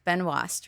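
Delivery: "Benoist" said ben-WAHST; "Benoist" with a WAH vowel; French-style guess. Shown as a demonstration. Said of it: "I think you pronounce it".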